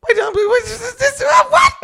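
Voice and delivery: high, stammering mock hype; hyped up. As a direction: sputter it in a very high voice. Acting out cocky hype before the scene.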